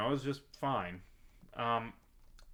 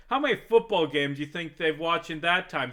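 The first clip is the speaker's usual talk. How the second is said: ordinary delivery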